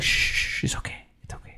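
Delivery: whispered, soft hushing; calm him like a spooked animal; soothing shush. Retold or imitated. Sir Strong soothing Daemon, soft hushed whisper.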